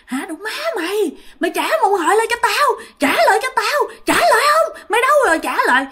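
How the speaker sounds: high angry shouting; furious yelling. Retold or imitated. Thi screaming at the demon for her pet pig, furious high yell. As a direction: scream at it to give the pig back. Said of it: "Thi la làng lên".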